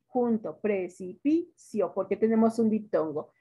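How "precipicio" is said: "precipicio" slowly broken into pre, ci, pi, cio; syllable by syllable. Shown as a demonstration. Teacher showing the word's division, syllable by syllable. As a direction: split "precipicio" into four slow beats, cio as one. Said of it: "Cómo van a dividir precipicio".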